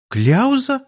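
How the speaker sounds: high, puzzled echo question; uncomprehending. A narrator's reading. Girl interrupted mid-dialogue asks back, uncomprehending. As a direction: jump up in pitch, baffled. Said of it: "непонимающе переспросила всё ещё что-то говорящая девушка".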